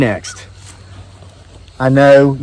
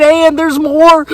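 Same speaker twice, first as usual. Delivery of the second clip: dramatic reveal; 11 semitones higher, slower than usual. Retold or imitated. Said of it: "He said".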